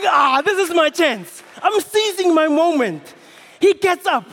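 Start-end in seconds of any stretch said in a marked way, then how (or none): 0.1-3.1 high, loud eager exclamation; excited. Acted. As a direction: seize the chance with joy. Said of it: "It's going".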